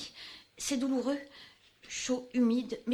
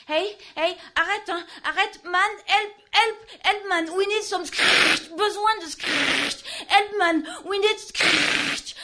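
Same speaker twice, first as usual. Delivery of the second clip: much higher and louder, pleading yell; panicked cries for help; 8 semitones higher, 8 dB louder, slower than usual. Acted. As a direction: scream for help as if trapped.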